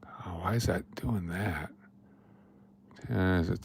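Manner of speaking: whispering to himself under his breath; a puzzled whisper, mostly to yourself; whispered mutter. Real, not acted. Host mutters at his stream settings in a puzzled whisper.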